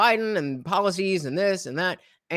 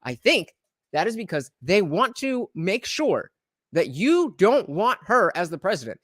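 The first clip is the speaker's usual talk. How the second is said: usual speaking voice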